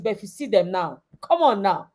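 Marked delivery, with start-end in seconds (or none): none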